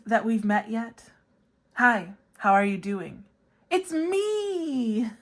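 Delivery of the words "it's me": much higher, hammed-up diva voice. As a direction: croon it like a pop ballad. [name] Hello parody.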